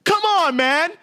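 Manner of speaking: a tame, polite burst of anger; mild Canadian madness. Retold or imitated. Speaker acting stage one Canadian anger at a flight attendant, high mock protest. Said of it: "the stage one Canadian madness".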